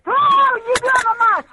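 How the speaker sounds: irritated loud swearing question; annoyed shout. Real, not acted. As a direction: snap it out, fed up. Old woman annoyed, can't hear.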